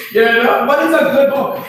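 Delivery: amused, high-pitched aside; still laughing. Real, not acted. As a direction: say it while getting over a laugh. Recovering from laughing at own joke.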